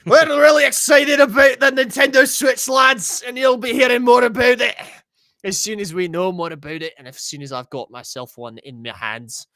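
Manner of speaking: hammed-up Scottish accent, loud and high at first, then settling. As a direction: play a rowdy Scottish streamer hyping the Switch to the lads. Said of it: "I'll just talk like this for the rest of the time".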